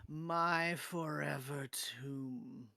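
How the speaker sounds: whispery, very slow ghost voice; drawn-out spectral whisper; cursed spirit. Acted.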